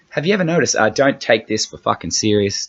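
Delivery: ordinary talk, nothing marked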